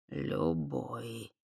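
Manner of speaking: slow, low and soft musing; thoughtful. Acted. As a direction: repeat it slowly, lost in thought. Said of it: "задумчиво повторил полудух".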